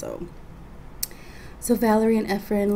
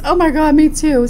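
louder, higher mock delight; excited friend; 7 semitones higher, 6 dB louder than usual. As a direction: squeal it like a friend who is bored too. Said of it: "And she goes".

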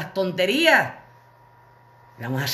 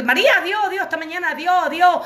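much higher, rushed goodbyes; hurried calling out; 9 semitones higher than usual. Retold or imitated. Running home, calling goodbye to María, much higher and hurried. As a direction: fling goodbyes back while running.

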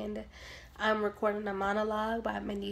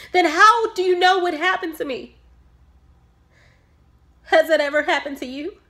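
loud, raised-pitch outburst at the doctor; angry confrontation; 5 semitones higher, 10 dB louder than usual. Acted. Bereaved mother challenging her doctor about that day, angry. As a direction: demand answers, voice climbing with anguish.

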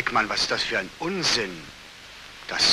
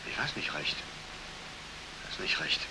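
whisper; 10 semitones lower, 15 dB quieter than usual. Acted. Colonel evasive mumble.

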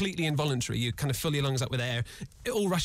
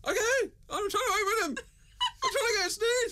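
high, strained pleading; strained and distressed. Real, not acted. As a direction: voice climbing in discomfort. Eyes held open, straining.

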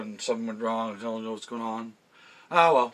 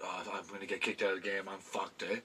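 muttered low and quick under the breath; worried mutter to self; 3 semitones lower, 11 dB quieter than usual. Retold or imitated. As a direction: mutter it to yourself like you're about to get caught. Panicked thought, about to be ejected.

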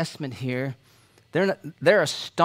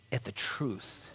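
hushed; 4 semitones lower, 12 dB quieter, slower than usual. Real, not acted. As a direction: drop to a near whisper on the truth. Preacher drops voice on key phrase.

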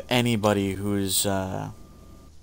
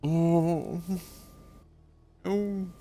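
usual speaking voice

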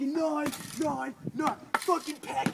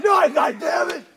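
loud, drawn-out yelled count; mock drill-sergeant roar; slow bellow; 11 dB louder, slower than usual. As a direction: drag out the yell like a furious sergeant.

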